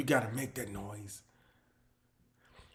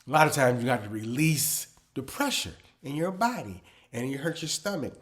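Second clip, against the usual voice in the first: normal talking voice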